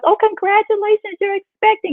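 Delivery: high, bright mock doctor announcing good news; cheery doctor. Doctor tells her she's pregnant, high cheery voice. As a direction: play the upbeat doctor breaking the news. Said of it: "And the doctor was like".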